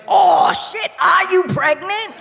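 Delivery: the saleswoman blurting in alarm; consultant's blurt. Retold or imitated. Consultant Deb blurting at the bride, startled shout. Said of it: "she went".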